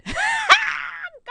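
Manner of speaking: high shrieking burst of laughter; loud squealing laugh at her own nerve. Real, not acted. Laughs recalling raising her hand.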